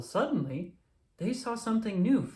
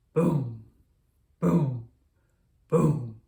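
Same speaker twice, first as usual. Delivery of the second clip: loud booming fireworks; 7 dB louder, much slower than usual. A narrator's reading. Narrated firework noise.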